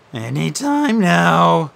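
ordinary delivery, nothing marked

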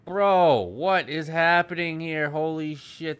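loud shocked outburst; alarmed shout. Real, not acted. Shocked at tied-up women.

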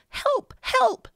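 high, urgent cry for help; calling for help. A narrator's reading. Boy phones a policeman, urgent plea. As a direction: raise the pitch as if calling in an emergency.

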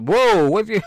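high, loud "whoa" of shock; recoiling at a weird order; mock startled. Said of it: "I'm like".

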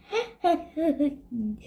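a quiet mocking snicker; soft snicker. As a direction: snicker under your breath. Petey laughing.

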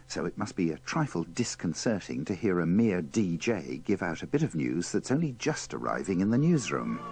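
usual speaking voice

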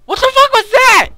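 high, loud panicked shout. Real, not acted. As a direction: yell in real shock. Right after the jump scare.

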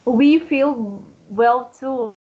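slow, careful teacher voice; slow and clear. Shown as a demonstration. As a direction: spell it out slowly for a learner. Tutor speaks clearly to a learner.

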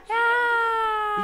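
short high startled shout; sudden startled yelp; sudden high cry. Real, not acted. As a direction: yelp out in surprise. Baby startles, parent cries out.

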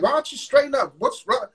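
high, sharp snap at her; snapping. Retold or imitated. Husband snapping at his crying wife over dinner, pitched high. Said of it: "still snapping".

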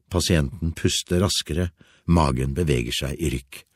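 ordinary delivery, nothing marked

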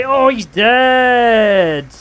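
loud, high, long-held cry; drawn-out shout. Real, not acted. Gamer reacting to a rival's wreck, drawn-out shout. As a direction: holler it out, stretching the last word.

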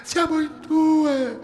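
put-on a little girl begging faintly for help; trapped child calling up to the rescuers; weak child's plea. A narrator's reading. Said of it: "le invocazioni adesso deboli".

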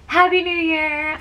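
bright cheerful New Year wish; excited. Real, not acted. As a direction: call it out happily. Wishing viewers happy new year.

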